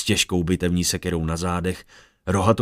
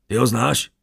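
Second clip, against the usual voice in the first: a touch louder, higher call; lightly raised hail; mild call; 4 semitones higher, 4 dB louder than usual. Acted. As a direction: call the question over to him. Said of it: "houkl na něj Aaron".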